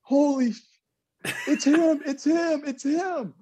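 friends freaking out as the phone rings; excited shouting. Retold or imitated. Friends yelling as Ted Williams calls, excited high shout. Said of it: "I hear my friends go".

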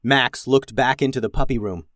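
ordinary delivery

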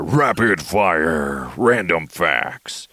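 slow, drawn-out segment announcer; mock announcer. Host launching random facts segment, drawn-out mock announcer. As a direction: stretch it out like a show intro.

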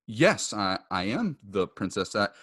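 usual speaking voice

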